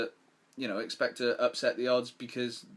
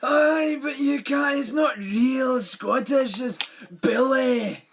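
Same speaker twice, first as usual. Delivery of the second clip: much higher, loud hammed-up Scottish accent shout; big comic Scottish accent, pitched way up; mock Scottish accent; 14 semitones higher, 9 dB louder, much slower than usual. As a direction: bellow it in a cartoon Scottish accent. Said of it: "Billy Connolly, phenomenal".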